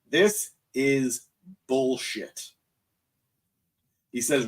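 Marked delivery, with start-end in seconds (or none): 0.1-2.5 slow, deliberate "This is bullshit", each word spelled out; emphatic. Retold or imitated. Cody telling Roman off in the ring, slow emphatic retort. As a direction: lay every word down flat and firm. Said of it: "very plainly, very emphatically".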